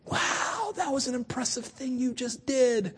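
mock-awed believer gasping at a small miracle; breathy awed wow. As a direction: marvel like someone impressed by a small trick. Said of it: "it's almost like we're saying".

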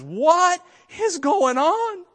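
high, loud, slow panicked plea; frightened cry. Retold or imitated. Frightened wife calling on the Lord, high panicked cry. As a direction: cry out to God in alarm. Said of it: "calls on the Lord and says".

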